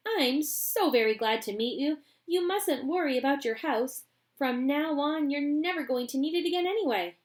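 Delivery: cheerfully reassuring; Mister Wonka. Acted. Wonka greets Mister Bucket warmly, jolly.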